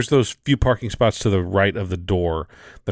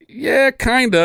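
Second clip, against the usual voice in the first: reluctant yeah; 13 semitones higher, 4 dB louder, slower than usual. Retold or imitated. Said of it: "And I'm like".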